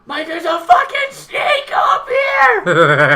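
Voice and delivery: high, loud, terrified yell for help; panicked scream. Retold or imitated. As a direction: scream at the boss in sheer terror. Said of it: "I was like".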